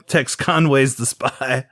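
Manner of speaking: usual speaking voice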